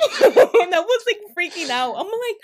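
high and loud, laughing as you start. Real, not acted. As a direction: laugh through the memory of panic. Laughing at the memory.

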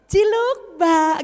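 high, loud teasing peekaboo call; playful flirty husband. Acted. Doctor mocks a goofy husband calling his wife, high and loud. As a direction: tease her like a silly husband playing peekaboo.

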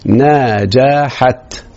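نجحت stretched very slowly with a falling end; drawn-out word. Shown as a demonstration. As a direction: draw out نجحت for the class. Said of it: "نهى ده مبتدأ مرفوع". (مدرس يمد كلمة نجحت ببطء شديد)

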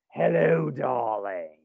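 sultry, drawn-out greeting; femme fatale. Pretend. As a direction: purr it like a glamorous vamp. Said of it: "I'm gonna be like".

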